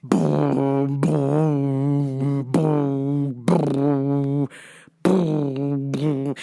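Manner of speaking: slow low mouthed booms; imitated bass thump. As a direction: thud it out like a bass drop. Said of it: "Like when they play".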